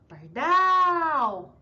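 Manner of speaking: pardal with DAL long and loud; stronger stressed syllable. Shown as a demonstration. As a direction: punch and stretch the last syllable of "pardal". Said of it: "dizendo de sílaba mais forte".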